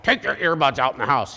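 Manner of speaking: grumbling old-man put-on voice; crotchety old guy. Dad telling teens about earbuds, crotchety old guy. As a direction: play the grouchy old dad. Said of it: "I'm the crotchety old guy".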